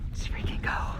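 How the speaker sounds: whispered, breathless hype. Real, not acted. Diver hyping himself up before the dive, whispered. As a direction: psych yourself up under your breath. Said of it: "whispered pump-up".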